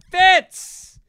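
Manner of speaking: high, loud call across a bar; imitated greeting yell. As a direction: holler the nickname like greeting an old pal. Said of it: "somebody would yell out".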